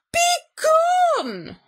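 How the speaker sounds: high, drawn-out furious bellow; roar. A narrator's reading. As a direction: roar the intruder out of the house. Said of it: "roared Bear".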